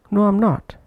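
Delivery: "I'm" reduced between a high "No" and "not"; weak-form "am". Shown as a demonstration. Teacher demonstrating the weak form in an answer, "I'm" not prominent. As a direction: keep the "am" in "I'm" short and unstressed. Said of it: "that's why not pronounced prominently".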